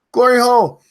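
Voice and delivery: high, loud shout of the name; screaming a name. Real, not acted. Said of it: "If you want me to scream your name".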